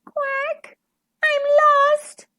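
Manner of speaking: very high, tiny duckling; forlorn, high baby-duck voice; small, sad voice. A narrator's reading. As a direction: squeak it like a lost little one. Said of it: "heard a small, sad voice".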